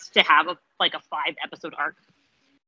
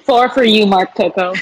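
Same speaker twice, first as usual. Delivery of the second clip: louder, punchy "Four for you" with a falling tune; loud movie-quote callout; 12 dB louder than usual. Acted. Cheering Mark by shouting the movie line, loudly. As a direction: belt out the famous line like a cheer. Quoting Mean Girls line as joke.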